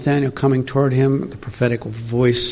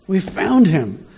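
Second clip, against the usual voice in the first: higher, eager announcement; excited discovery; 7 semitones higher, slower than usual. Acted. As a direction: tell them you found him, thrilled. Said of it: "I can hear the excitement".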